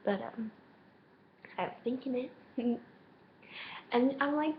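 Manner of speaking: ordinary delivery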